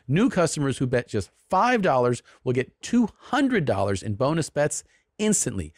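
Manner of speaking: upbeat sponsor pitch; ad read. Acted. Host reading the DraftKings sponsor spot in ad voice. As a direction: sell it like a sports promo.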